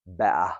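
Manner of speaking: better with a glottal stop in place of the t, be'uh; casual British better, t swallowed; very informal British. Shown as a demonstration. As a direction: say better like casual London speech. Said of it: "Very informal British".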